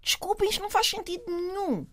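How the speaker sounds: high, emphatic protest to colleagues, lightly acted; mock indignant scolding. A teacher scolding fellow educators with mock indignation. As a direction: tell the staff firmly it is not allowed.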